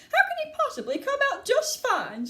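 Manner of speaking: very high, indignant retort; high, cross mother; snapping. A narrator's reading. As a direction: snap back in disbelief. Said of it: "snapped Missus Gloop".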